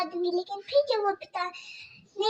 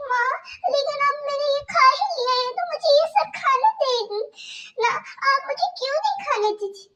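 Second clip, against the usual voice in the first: put-on much higher, small pleading girl voice; pleading young girl; 7 semitones higher, faster than usual. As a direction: Kajal begging her stepmother about stomach pain. Kajal pleads.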